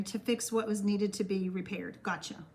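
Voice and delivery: usual speaking voice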